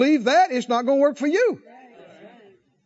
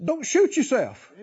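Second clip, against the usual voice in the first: ordinary talk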